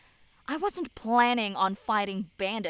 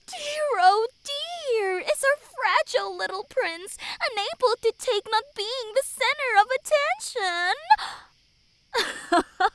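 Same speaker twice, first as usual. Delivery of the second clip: much higher, syrupy mock pity; sickly sweet taunt; 12 semitones higher, 5 dB louder than usual. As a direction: coo it sweetly while you needle him. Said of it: "The voice that followed was as sweet as can be".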